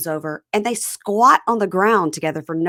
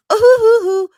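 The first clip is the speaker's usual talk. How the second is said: high, loud mock boo-hoo; fake crying; 13 dB louder than usual. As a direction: wail in obviously fake sobs. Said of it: "fake cries".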